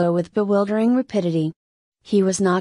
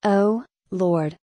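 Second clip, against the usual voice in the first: ordinary delivery, nothing marked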